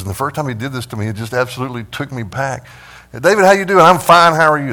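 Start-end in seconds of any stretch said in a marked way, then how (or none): 3.1-3.8 big, loud, high friendly hello; the elder booming a cheery greeting; hearty greeting. Retold or imitated. Elder greeting David in the foyer, loud and cheery. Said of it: "an elder in the congregation". 3.8-4.7 loud, high automatic reply; breezy stock answer. Retold or imitated. David brushing off the elder, loud and breezy. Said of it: "That's the answer".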